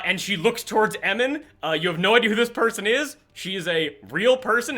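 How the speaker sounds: ordinary talk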